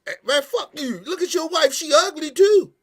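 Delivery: mock a guy insulting another man's wife, loud and high; loud, high mocking taunt at a rival; mock trash talk. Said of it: "and you like".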